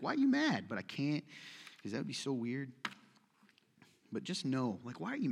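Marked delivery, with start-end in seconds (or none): 0.0-0.7 higher, bemused question; mock puzzled question. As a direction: ask it with playful disbelief. Imagined face-to-face question.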